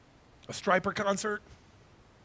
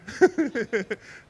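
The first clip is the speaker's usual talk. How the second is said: delighted laugh; 6 semitones higher, 6 dB louder than usual. Real, not acted. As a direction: laugh with real joy at his daughter. Playing with baby daughter.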